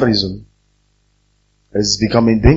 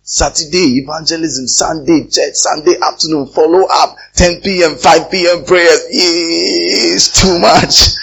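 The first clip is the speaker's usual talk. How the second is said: mock grievance list, higher and building louder to a drawn-out finish; exasperated complaint; 6 semitones higher, 4 dB louder, slower than usual. Pastor mimics members overloaded with church meetings, exasperated outcry. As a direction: complain with mounting exasperation, stretching the last words.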